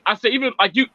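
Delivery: ordinary delivery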